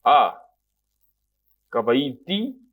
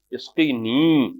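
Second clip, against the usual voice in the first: slow model reading; much slower than usual. Shown as a demonstration. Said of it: "اسقی نی، (teacher models the phrase)".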